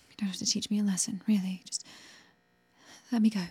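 fast, whispered plea; hurried whisper. Acted. Woman begging the captor not to punish her, fast whisper. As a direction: rush the plea under her breath.

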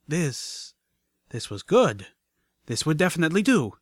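energy surging through her; rising excitement. Acted. Drug rush kicks in.

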